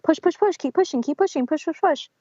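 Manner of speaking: high, insistent mock coaching chant; forceful midwife. Midwife forcefully coaching mother through crowning, insistent mock chant. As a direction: push her on like a pushy coach. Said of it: "being really forceful with me".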